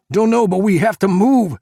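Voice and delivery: high, loud urgent call; urgent shout. A narrator's reading. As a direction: yell to get moving. Fleeing burning building, urgent.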